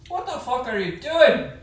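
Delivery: high, loud angry yell; party member yells back in Jordan's voice. Acted. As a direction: shout at the lagging party in a borrowed voice. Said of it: "That's my voice, asshole".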